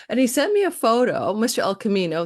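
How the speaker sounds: ordinary talk, nothing marked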